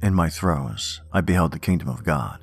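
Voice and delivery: ordinary delivery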